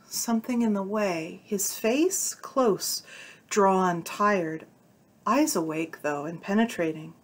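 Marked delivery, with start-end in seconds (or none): none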